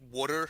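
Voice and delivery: "Water" said the Philly way, as "wooder"; "Water" with a Philadelphia "oo" vowel; Philly pronunciation. Retold or imitated. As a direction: imitate a Philly local saying "Water" as "wooder". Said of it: "they always say, like".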